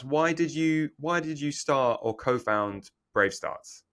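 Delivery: ordinary talk, nothing marked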